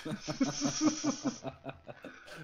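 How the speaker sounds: high peal of laughter; real high-pitched laugh. Real, not acted. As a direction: burst out laughing. Laughs after motherland line.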